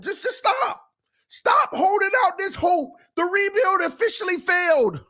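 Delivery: exasperated shout. Real, not acted. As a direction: shout it, fed up with the false hope. Insists the rebuild failed.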